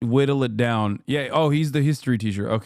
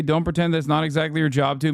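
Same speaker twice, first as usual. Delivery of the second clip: usual speaking voice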